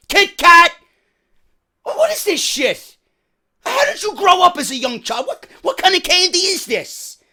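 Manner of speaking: loud, high-pitched disbelief at the candy; outraged rant. Retold or imitated. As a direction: berate the manager as if betrayed. Ranting at manager over candy.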